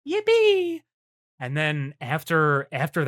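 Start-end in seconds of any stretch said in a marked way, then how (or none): 0.1-0.8 high, bright cheer, squealed out; yippee cheer. Real, not acted. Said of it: "cheering again".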